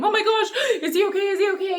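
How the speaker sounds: high, fretful "is he okay", pitched well up with a nervous laugh; replaying the old worry, half-laughing and anxious; mock anxious worry. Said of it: "that anxiety of".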